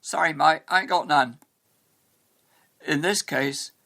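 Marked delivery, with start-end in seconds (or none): 0.0-1.4 louder common British street accent; slangy English accent, "mate" as "mite"; colloquial British accent. Shown as a demonstration. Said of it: "what if the person you're talking to replied".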